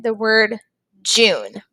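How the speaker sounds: normal talking voice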